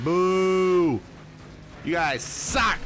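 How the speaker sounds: playful booing. Pretend. Mock booing the AI racers.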